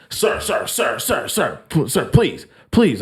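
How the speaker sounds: high, frantic pleading sirs; pleading attendant. Retold or imitated. Tow guy begging the teller to back off, high pleading.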